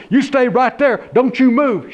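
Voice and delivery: high loud holler at the snake. A narrator's reading. Deputy hollering at the snake, loud. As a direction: yell it, gun drawn.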